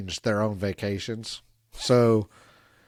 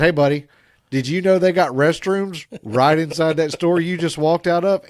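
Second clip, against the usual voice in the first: raised voice across a parking lot; calling out; 5 semitones higher, 6 dB louder than usual. Retold or imitated. Calling out to a man peeing in a parking lot, raised voice. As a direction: call out to the guy, a bit louder. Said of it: "I said".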